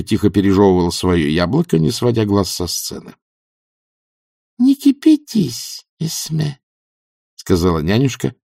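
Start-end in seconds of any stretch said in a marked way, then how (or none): 4.6-6.6 high, drawn-out admonition; slow, high old-woman voice; calming old witch. A narrator's reading. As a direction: soothe a friend in a high, slow voice. Nanny tells Granny to calm down.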